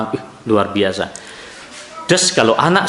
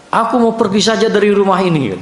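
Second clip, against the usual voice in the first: son's angry words; 7 semitones higher than usual. Retold or imitated. Father retelling his son's threat, raised mock child cry. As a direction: voice the boy's outburst with a raised pitch.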